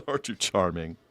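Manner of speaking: mock-genteel villain, laughing between threats. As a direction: chuckle through cheerful menace.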